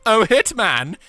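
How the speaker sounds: high, loud mock host call; hammy mock call. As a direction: sing out the name with glee.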